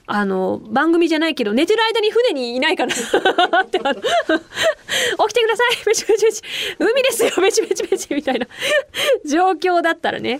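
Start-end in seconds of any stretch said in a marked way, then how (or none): 4.0-8.1 lightly acted, high-pitched wake-up call; high, giggly pretend waking; playful wake-up call. Staff waking a sleeper at sea, playful high voice. Playing waker on a ship.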